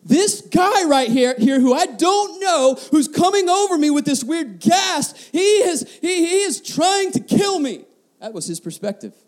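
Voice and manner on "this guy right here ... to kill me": put-on higher, frightened little boy; pitched-up panicky child at the dentist; scared child's voice. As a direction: voice a terrified five-year-old. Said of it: "what was going on in the mind of my five-year-old".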